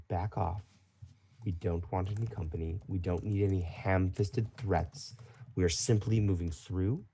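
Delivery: soft, controlled refusal; quiet, firm dismissal. Acted. Woman rejecting ham-fisted threats at her table, quietly firm. GM voices woman sending player away.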